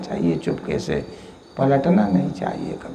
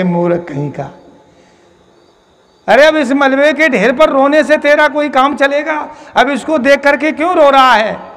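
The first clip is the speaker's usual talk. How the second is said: higher, louder holy-man rebuke; scolding shout; 8 semitones higher, 4 dB louder than usual. Acted. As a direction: scold him sharply as a fool.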